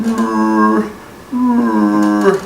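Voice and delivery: high, loud, drawn-out mock turtle roar; turtle moaning roar. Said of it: "it was going moaning".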